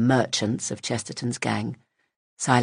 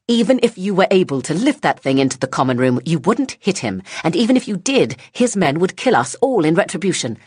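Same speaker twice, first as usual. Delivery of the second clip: angry scolding; 6 semitones higher, 6 dB louder than usual. Acted. Woman scolds child sharply.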